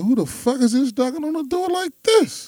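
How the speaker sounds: high, startled mock outburst; acting out being woken by knocking; startled yell. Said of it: "I'm like".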